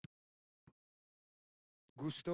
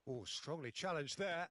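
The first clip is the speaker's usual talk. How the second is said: ordinary talk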